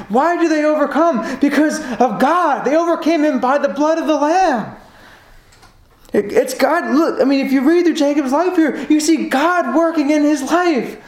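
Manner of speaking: pounding out "God breaking him, God helping him"; fervent preaching. Acted. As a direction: build it up like a revival preacher. Builds to climax.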